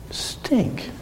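breathy whisper, a wry aside. Retold or imitated. Telling the church his thought after surviving, whispered. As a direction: whisper it like a private joke.

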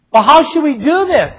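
high, loud demon shout. A narrator's reading. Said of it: "his demon shouted".

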